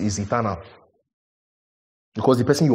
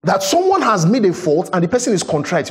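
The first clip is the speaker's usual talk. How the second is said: higher and louder, building preacher's cry; fervent sermon delivery; full-force preaching; 8 semitones higher, 4 dB louder than usual. Acted. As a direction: preach it out, rising to the peak. Preaching climax.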